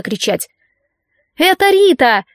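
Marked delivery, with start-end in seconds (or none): 1.4-2.2 high excited call across the street; yelled. A narrator's reading. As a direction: shout it out from across the road. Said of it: "заорал кто-то на противоположной стороне".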